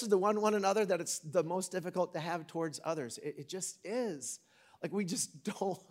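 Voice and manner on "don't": ordinary talk, nothing marked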